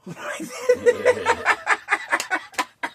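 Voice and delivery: a burst of high, delighted laughter. Real, not acted. Laughing while telling his friend the story. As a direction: crack up at the memory.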